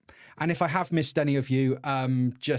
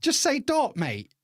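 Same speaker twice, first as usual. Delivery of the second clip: exasperated disbelief; 12 semitones higher, 3 dB louder than usual. Retold or imitated. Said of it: "I'm like".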